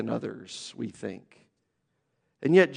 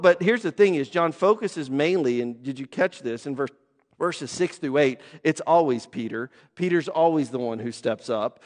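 ordinary talk

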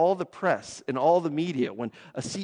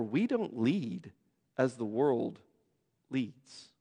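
quiet solemn emphasis; 5 semitones lower, 10 dB quieter, slower than usual. Real, not acted. Preacher slowing to his point.

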